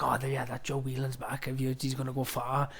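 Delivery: soft, low murmur of gossip; retold hushed gossip. Retelling talk at auditions, hushed gossip.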